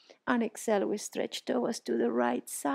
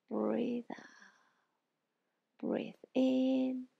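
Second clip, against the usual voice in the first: guided breathing; 4 dB quieter, much slower than usual. Shown as a demonstration. Guided stretch breathing cues.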